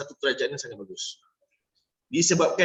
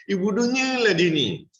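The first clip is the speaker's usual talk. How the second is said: mock insult; 4 semitones higher than usual. Shown as a demonstration. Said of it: "Pemilihan perkataan".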